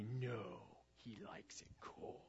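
put-on slow, eerie whisper of a possessed boy; chilling possessed-child whisper; creepy whisper. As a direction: breathe it out like something's wrong with him. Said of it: "is like that creepy".

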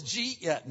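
"did you eat yet" squashed into "jeet yet"; slurred dialect form. Shown as a demonstration. Preacher quotes the puzzling local phrase, slurred into "jeet yet". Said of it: "you know how they talk there".

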